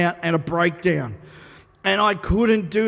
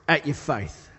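ordinary talk, nothing marked

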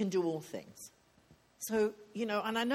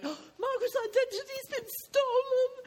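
high, squeaky mock panic; 4 dB louder than usual. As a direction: ham up a flustered fret. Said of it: "in a panic in heaven going".

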